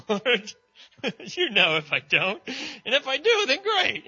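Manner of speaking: exasperated Peter. Acted. Peter answering Jesus a third time, loud and exasperated. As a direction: throw up your hands and blurt it out.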